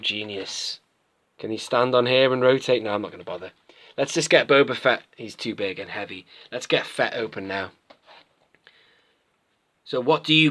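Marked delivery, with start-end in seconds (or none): none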